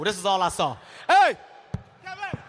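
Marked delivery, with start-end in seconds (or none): 1.1-2.4 dad yelling. Retold or imitated. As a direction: holler the name like a proud dad. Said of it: "Let me show y'all how my dad walked".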